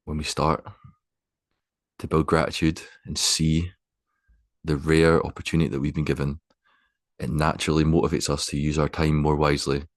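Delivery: unhurried, spacious guidance, letting each phrase settle; guided meditation. Acted. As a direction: guide them gently into stillness, phrase by phrase.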